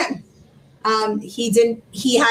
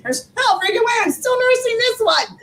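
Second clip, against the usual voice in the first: much higher, incredulous protest; stunned disbelief; 11 semitones higher than usual. Retold or imitated. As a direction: refuse to believe it, voice up high. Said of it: "I'm like".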